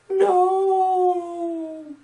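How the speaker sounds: long, very high wail; mock anguished cry. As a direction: wail a drawn-out mock lament. Mock grief at a spoiler.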